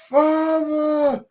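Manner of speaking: very high, drawn-out mock cry; acted exclamation. [name] thinks back on the word daddy, high-pitched mock exclamation. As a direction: put on a high voice and hold the word.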